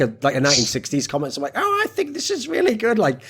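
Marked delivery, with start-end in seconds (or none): 1.6-3.0 high, silly put-on voice; mock someone in a goofy falsetto; ridiculous mocking voice. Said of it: "that ridiculous voice as though someone's talking and you're obviously mocking them".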